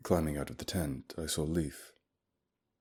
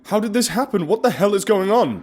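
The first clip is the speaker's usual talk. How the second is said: furious shout, much higher and louder; 13 dB louder than usual. Acted. Narrator shouting at guide Leif over the frozen friend, furious shout. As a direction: yell it at the guide in disbelief. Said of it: "I shouted".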